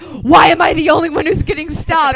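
loud, high, outraged whine; indignant complaint. Retold or imitated. Friend stopped at security, loudly indignant. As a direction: protest the unfairness loudly. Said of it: "He's like".

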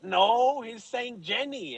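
high-pitched mock protest, drawn out; protesting listeners. Listeners correcting his Forrest Gump claim, mock protest. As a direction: object in a high, stretched imitation voice. Retelling listeners objecting to him.

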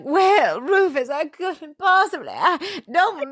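high, breathy-sounding hammed-up protest; fluttery soap heroine. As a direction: flutter helplessly like a melodramatic heroine. Lily's reply voiced.

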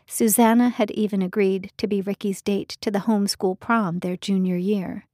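ordinary delivery

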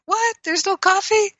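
high squeak of shocked disbelief; surprised. Shown as a demonstration. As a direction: gasp it out, caught off guard. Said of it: "was surprised".

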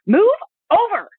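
sudden high angry snap; angry pregnant lady. Retold or imitated. Pregnant woman to a man spreading on the subway, sharp angry snap. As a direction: bark it at the subway seat hog. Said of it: "That angry pregnant lady".

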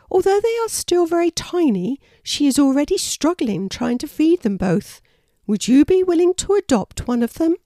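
high, projected storybook character; high-pitched animal mum explaining; earnest mother wallaby. A narrator's reading. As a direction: make an appeal in a high, strong wallaby voice. Wanda explains and asks a favour.

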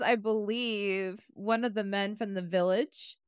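ordinary talk, nothing marked

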